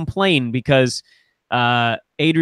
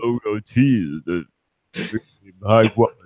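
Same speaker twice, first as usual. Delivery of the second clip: character impression; 6 semitones lower than usual. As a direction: drag the words out in a strange character voice. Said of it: "do that one impression that you know".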